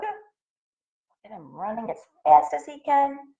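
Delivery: ordinary delivery, nothing marked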